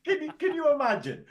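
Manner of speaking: high, loud can you imagine; giddy incredulous question; excited disbelief. Real, not acted. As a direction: squeal the question in delight.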